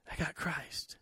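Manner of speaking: very soft, low punchline; hushed divine answer. Acted. Preacher reveals God's answer is Christ, hushed and low. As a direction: drop right down to a near hush.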